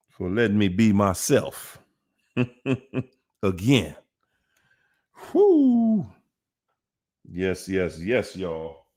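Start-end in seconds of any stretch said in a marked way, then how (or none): 5.3-6.0 high, breathy-free quick whoop; excited whoo. Real, not acted. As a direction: let out a high whoop of joy. Excited exclamation.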